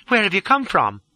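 high, insistent woman's question; demanding. A narrator's reading. Lola questioning the fugitive in her coach, demanding. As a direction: press for an answer. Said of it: "she demanded".

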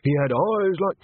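high, testy insistence; peevish, cut-short retort; irritated snapping. A narrator's reading. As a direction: push on crossly with your story. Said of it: "snapped Tommy".

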